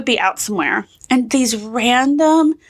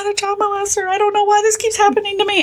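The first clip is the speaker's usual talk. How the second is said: high, whiny protest; mock exasperated panic; 9 semitones higher, faster than usual. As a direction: protest like a flustered dad. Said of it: "He's like".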